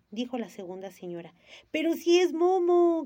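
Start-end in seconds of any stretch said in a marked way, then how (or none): 1.7-3.0 louder, higher, slow call with a laugh. Acted. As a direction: exclaim it through delight. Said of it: "gritó Gigi riéndose".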